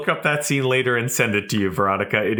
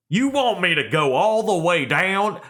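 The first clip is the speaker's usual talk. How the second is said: bad Southern accent; 5 semitones higher than usual. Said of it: "a British person trying to do a Southern accent".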